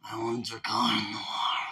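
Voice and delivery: whispered sinister movie-villain line; creepy villain whisper. Pretend. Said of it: "You sounded like those greasy fucks".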